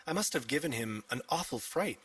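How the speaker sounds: plain reading voice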